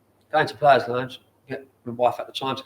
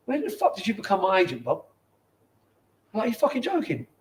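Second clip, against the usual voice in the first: higher, incredulous retelling of a furious reply; retold exasperated outburst; 7 semitones higher than usual. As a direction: replay the disbelief, pitched up. Retold outburst at Bob on phone.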